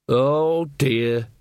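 usual speaking voice

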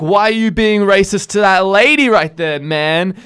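high, loud, drawn-out question; hammed-up righteous protest; mock indignant challenge. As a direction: take her to task, slowly. Acting out his side of quarrel.

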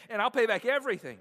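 high, loud, hurried plea for mercy; loud begging. A narrator's reading. Servant begging his master on his knees, loud and urgent. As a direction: beg aloud, fast and desperate. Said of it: "he begged".

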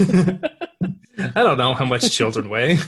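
laughing. Real, not acted. Laughing at weight joke.

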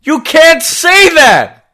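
high, loud, slow shout; shocked shout. Real, not acted. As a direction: yell it in alarm. Censoring a co-host.

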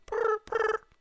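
very high trilled ringing noise; phone ring imitation. Comic acting out the phone call, high trilled ring.

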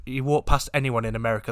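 ordinary delivery

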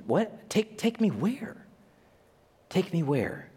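high, puzzled questions; baffled, reaching up in pitch; confused disbelief. Retold or imitated. Hopkins confused.

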